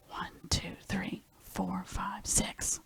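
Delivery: a soft unvoiced whisper, each number rising; whispered counting. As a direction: build suspense with a secret count. Counting remaining birds.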